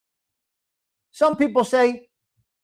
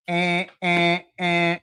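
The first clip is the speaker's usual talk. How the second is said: imitated busy signal; 3 semitones lower, much slower than usual.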